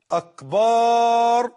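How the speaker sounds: «أكبر» drawn far out as «أكبار», pushed up; wrong long vowel. Shown as a demonstration. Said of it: "هو الطبل". («أكبر» ممدودة جدًا: «أكبار»)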